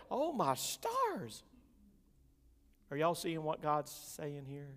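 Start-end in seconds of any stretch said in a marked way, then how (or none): 0.1-1.4 awed exclamation. Real, not acted. Reaction to curses.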